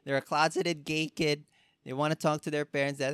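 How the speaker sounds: normal talking voice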